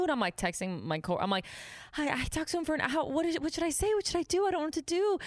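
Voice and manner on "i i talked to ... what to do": high, panicky questioning; retold panic, pleading for advice; scared. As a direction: replay frantic worry, voice pitched way up. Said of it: "I was, like, scared".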